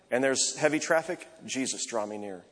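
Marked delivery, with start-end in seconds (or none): 1.5-2.4 soft, lower murmured plea; quiet breath prayer. Shown as a demonstration. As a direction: breathe it out in the traffic. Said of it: "When I'm driving to work".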